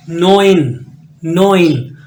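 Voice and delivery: नोइन said as noin, firmly; German neun. Shown as a demonstration. Teacher models German nine, firm. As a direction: say नोइन, oi not ai. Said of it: "नाइन".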